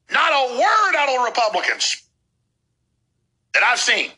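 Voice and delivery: worked-up, high-pitched tirade; heated rant. Real, not acted. Host railing at silent Republicans, heated high-pitched rant.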